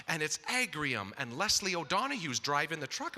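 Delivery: normal talking voice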